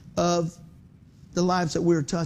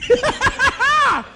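high, loud burst of laughter; big cackling laugh; 7 dB louder than usual. Retold or imitated. Dad gloating after flooring his son, big cackling laugh. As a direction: let out a gleeful cackle. Dad's triumph after the throw.

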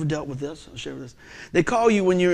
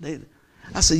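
ordinary talk, nothing marked

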